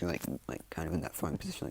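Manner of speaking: usual speaking voice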